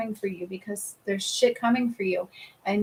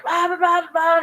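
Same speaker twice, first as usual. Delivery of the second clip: higher mild mock yell of nagging chatter; mock yelling mind; 7 semitones higher, 3 dB louder than usual. Restless mind during meditation, a mild mock yell. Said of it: "your mind starts yelling at you".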